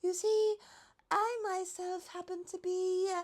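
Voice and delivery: high, light giraffe voice, politely worried; high fretful giraffe voice. A narrator's reading. Giraffe character voice.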